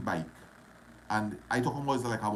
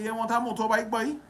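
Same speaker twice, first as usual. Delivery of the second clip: eager offer; 11 semitones higher than usual. Retold or imitated. Retold dialogue giving chain away.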